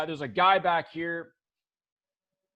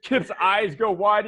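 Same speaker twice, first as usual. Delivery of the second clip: ordinary delivery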